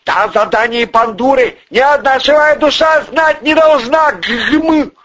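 high, loud tirade; full-voiced, high-pitched fury; furious yelling. A narrator's reading. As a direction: yell at a bungling subordinate. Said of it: "завопил Порешало".

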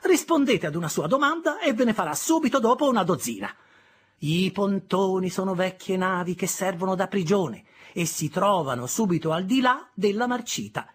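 high, loud complaint; protesting. Acted. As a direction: complain about the boy. Sister scolds.

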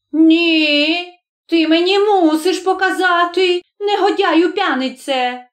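high, strident scolding; high-pitched quarrelling old woman; old woman's cry. A narrator's reading. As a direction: cry out high in outraged demand. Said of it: "крикнула баба".

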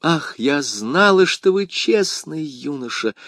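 high, slow, grateful exclamation; relieved exclamation. A narrator's reading. Madame Bonacieux thanking him for leaving, glad exclamation. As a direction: exclaim with lifted pitch, stretching the words. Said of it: "воскликнула госпожа Бонасье".

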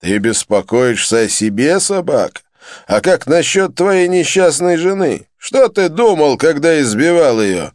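loud contemptuous tirade; angry scolding. A narrator's reading. Andhkara berates Joseph for beating his wife, contemptuous. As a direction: berate the wife-beater with scorn.

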